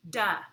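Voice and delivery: "da" said like the first sound of dig; beginning sound. Shown as a demonstration. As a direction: punch out "da" on its own. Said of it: "help me say the beginning sounds".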